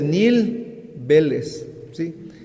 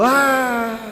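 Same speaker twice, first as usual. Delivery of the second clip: high sudden yell; high cry of pain; 8 semitones higher than usual. Retold or imitated. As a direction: cry out as the head bursts. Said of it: "gritó".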